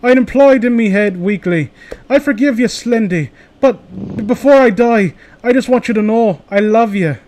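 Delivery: high, weakly pleading Irish voice; Irish accent. Acted. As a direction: put on a dying Irish kid. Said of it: "the kid's Irish too".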